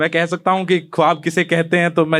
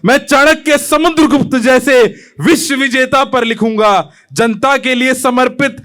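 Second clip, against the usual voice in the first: raised, rolling patriotic declamation; impassioned poem recitation; 7 semitones higher, 5 dB louder, slower than usual. Acted. Young poet pledging to rewrite India, impassioned. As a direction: proclaim it with drawn-out stresses.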